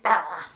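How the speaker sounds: mock defensive dog. Dog reacting to outstretched hands, mock defensive outburst. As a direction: imitate a dog lashing out.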